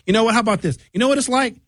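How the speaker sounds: high, needling know-it-all; mocking the people who nag him; mock nagging voice. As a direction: lecture him like an annoying advisor. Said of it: "people saying".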